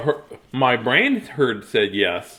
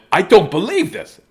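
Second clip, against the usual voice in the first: higher, louder amazed line; judge in disbelief; 7 semitones higher, 8 dB louder than usual. Acted. Judge can't believe Samuel keeps eating, amazed. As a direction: sound flabbergasted. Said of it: "The judge said".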